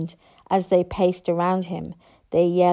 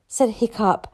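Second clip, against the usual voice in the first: plain reading voice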